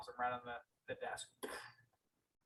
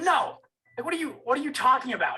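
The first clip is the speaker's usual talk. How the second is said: high, loud and fast disbelief; a stunned son pressing for answers; incredulous; 10 semitones higher, 10 dB louder than usual. Retold or imitated. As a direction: rattle it off in shock. Disbelief at the news.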